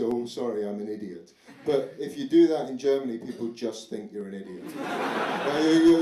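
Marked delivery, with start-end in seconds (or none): 0.0-1.3 mildly sheepish mock apology; apologetic. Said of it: "you can be really apologetic".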